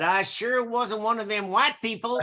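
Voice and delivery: chuckling through the punchline; amused, laughing aside. Real, not acted. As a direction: let laughter ride the words. Jokes about being mistaken ethnically.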